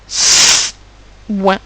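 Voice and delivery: "Soin" as a long hissed s, pause, then ouin; two separate sounds. Shown as a demonstration. Teacher showing there are two sounds, "Soin" segmented. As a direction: split "Soin" into sss and ouin. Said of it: "Puisqu'il y a deux sons".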